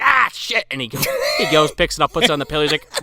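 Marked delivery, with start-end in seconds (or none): 0.0-0.7 high mock yell of frustration; retold cursing outburst. Imitating the leprechaun dropping the coin, exasperated yell. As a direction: exclaim it like a clumsy imp who missed.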